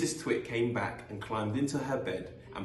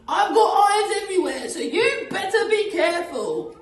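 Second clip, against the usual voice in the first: loud, very high gloating screech; Missus Twit cackling; 10 dB louder than usual. Acted. Missus Twit after her glass eye trick, high cackling. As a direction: cackle it triumphantly in falsetto. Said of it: "cackled Missus Twit".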